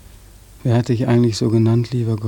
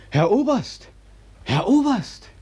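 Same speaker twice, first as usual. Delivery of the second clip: high, ringing call; high call to the passing colonel; 11 semitones higher than usual. Acted. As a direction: call him back down the street. Calling after the colonel.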